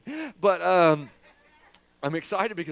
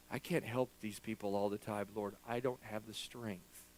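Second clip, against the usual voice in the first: weary quiet prayer; 7 semitones lower, 6 dB quieter than usual. Retold or imitated. Retold weary prayer in closet.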